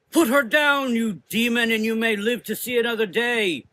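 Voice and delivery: high, loud ultimatum; commanding call. A narrator's reading. Mother Azra challenges the fire demon, loud commanding shout. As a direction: order the demon to drop her. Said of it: "Mother Azra called out to the creature".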